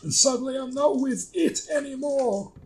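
high, cranky comic voice; hammed-up high-pitched doomsaying; put-on quoting voice. The two act out a quote.

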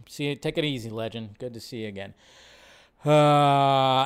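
normal talking voice

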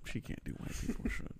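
whispered joke aside. Real, not acted. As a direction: drop to a whisper. Quiet aside to friends.

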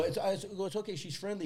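sing-song reassurance to a stranger. Retold or imitated.

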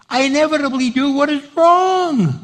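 high-pitched anguished recital; impassioned preaching. Acted. Preacher voicing Paul's struggle with sin, impassioned. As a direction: cry out the confession from the pulpit.

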